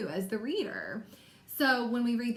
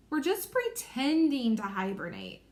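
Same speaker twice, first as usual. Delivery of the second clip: "pretending" punched out in an expressive character reading; stress on pretending; 2 semitones higher than usual. Shown as a demonstration. Teacher modeling a bold print word for pupils, "pretending" strongly emphasized. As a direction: lean hard on "pretending". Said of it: "I emphasize that word".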